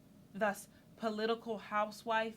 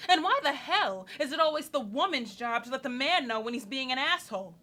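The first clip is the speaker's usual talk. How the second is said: loud rant peaking on the last question; angry challenge; 7 dB louder than usual. Acted. Woman refusing to manage Dave, angry challenge.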